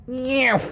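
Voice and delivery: high cartoon cat meow; imitated meow. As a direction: mew like a startled cat. Cat meowing in story.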